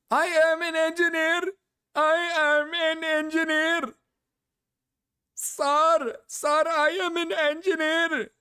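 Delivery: put-on high, pleading Indian call-center accent; mock Indian scam-caller accent. Mocking the streamer as an Indian scam caller, high put-on Indian accent. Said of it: "it's just like".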